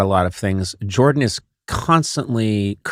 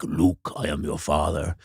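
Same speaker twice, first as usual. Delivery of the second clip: low, soft movie-villain line; mock-ominous, far lower voice; Star Wars villain quote; 8 semitones lower, 7 dB quieter than usual. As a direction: drop deep and quiet for the famous line. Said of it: "who's a big Star Wars fan".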